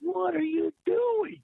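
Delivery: high, slow imitation of a baffled look; mock baffled. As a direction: voice a stunned glare as words. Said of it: "He kinda looked at me like".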